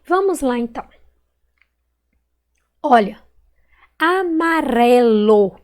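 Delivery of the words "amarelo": "amarelo" called out slowly, long RE; stretched stressed syllable. Shown as a demonstration. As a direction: draw out the RE in "amarelo". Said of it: "Qual que eu demorei mais falar".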